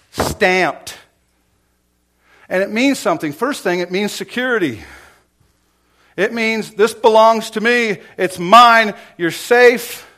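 loud, high, impassioned sermon delivery; full-force preaching. Real, not acted. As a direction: hammer it home to the congregation.

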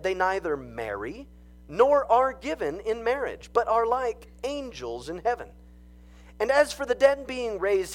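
scripture reading. Acted. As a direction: proclaim the passage to the congregation. Reads scripture aloud.

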